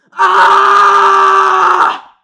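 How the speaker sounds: long, high, very loud yell; full-volume scream. Retold or imitated. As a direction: imitate a man screaming as loud as he can. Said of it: "As loud as he can".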